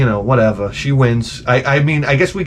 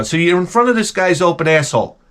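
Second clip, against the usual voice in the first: ordinary talk, nothing marked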